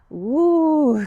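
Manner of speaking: high, loud playful whoop; excited woo. Shown as a demonstration. Teacher making noises with kids in tree pose, excited whoop. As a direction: cheer it out with the kids. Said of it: "Make some noises with me".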